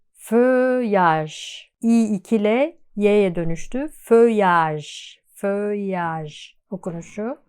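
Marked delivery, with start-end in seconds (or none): none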